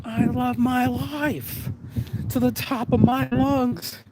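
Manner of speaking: ordinary delivery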